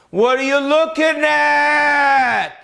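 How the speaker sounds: high, slow, hammed-up shouted challenge; angry gym guy. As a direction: bark it at him like a gym bro spoiling for a fight. Gym guy confronts him.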